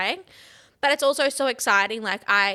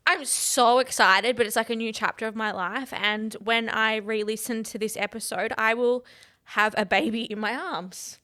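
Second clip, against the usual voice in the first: ordinary talk, nothing marked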